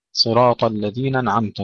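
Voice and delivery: الذين أنعمت run together as "ladhina-n'amta"; dropped hamza. Shown as a demonstration. Teacher showing common error, hamza dropped from أنعمت. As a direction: elide the glottal stop opening أنعمت. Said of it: "بحذف الهمزة".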